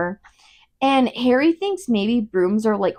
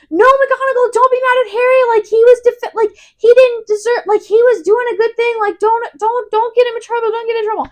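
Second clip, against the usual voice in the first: high-pitched, insistent group protest; mock pleading; 13 semitones higher, 5 dB louder, faster than usual. Everyone begging McGonagall for Harry, high mock pleading. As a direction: plead in a high voice, tumbling over the words.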